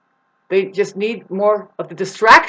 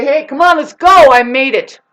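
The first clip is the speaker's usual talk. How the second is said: loud, high shout of encouragement; rallying call; 7 semitones higher, 11 dB louder than usual. Acted. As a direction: call back to those behind you. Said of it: "reach back and say".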